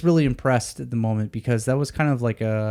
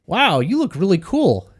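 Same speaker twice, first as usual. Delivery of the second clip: higher, lightly voiced old man; a hint of an old driver, pitched up; old taxi driver; 7 semitones higher than usual. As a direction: play the chatty elderly cabbie. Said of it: "seventy-two year old, old guy".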